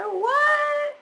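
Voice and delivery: high, loud startled yelp; stunned what. Real, not acted. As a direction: burst out in real disbelief. Shock at unknown relatives.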